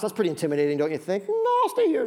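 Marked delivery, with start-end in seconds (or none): 1.3-2.1 high, prissy refusal; priest refusing. Retold or imitated. Preacher voicing the Levite priest declining, high squeak.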